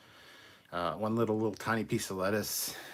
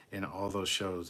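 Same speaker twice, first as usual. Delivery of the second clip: ordinary talk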